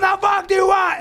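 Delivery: high, strained re-enacted outburst; mock exasperated yell. Man beating his chest in a fight, mock exasperated yell. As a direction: yell it in hammed-up desperation.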